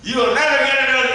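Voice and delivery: high, loud shout; loud holler. Shown as a demonstration. Parent throws the cut-up credit card at the kid, shouting. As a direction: holler it as loud as you can. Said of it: "holler as loud as you can".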